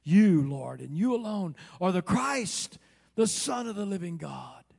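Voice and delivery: loud, rising proclamation from a punched "You"; ringing, high-voiced avowal; emphatic confession. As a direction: declare it with full preacher's force. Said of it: "here's the way he would have answered it".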